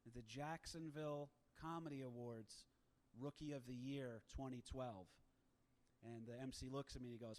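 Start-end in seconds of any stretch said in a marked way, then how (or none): 0.1-5.1 slow and deliberate, every syllable crisp; slow enunciation. Retold or imitated. Comic to MC before the show, slow careful enunciation. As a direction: spell it out slowly so the MC gets it right. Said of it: "I slowed down. I enunciated".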